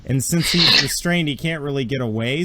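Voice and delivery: usual speaking voice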